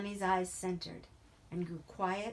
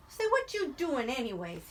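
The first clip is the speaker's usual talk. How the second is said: raised, high exclaiming voice; crying out; 6 semitones higher, 5 dB louder than usual. A narrator's reading. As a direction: call it out loud. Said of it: "he cried".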